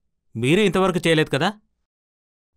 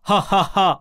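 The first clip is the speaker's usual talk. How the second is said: short pushed breath laughs; teacher showing the breath laugh; demo breath laugh; 4 semitones higher, slower than usual. Shown as a demonstration. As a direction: punch out each ha on a breath. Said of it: "హా హా అనాలి".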